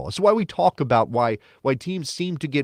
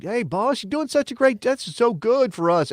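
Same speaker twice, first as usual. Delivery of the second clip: high, eager mock flattery of a player to his new boss; butter up the new boss in a lightly hammed-up high voice; sucking up to the boss; 12 semitones higher than usual. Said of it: "blowing some smoke because that's what you do".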